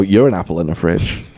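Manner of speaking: low deadpan comeback; dry, unbothered retort. Retold or imitated. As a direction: toss it back deadpan, low in pitch. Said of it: "it's always, like, deadpan".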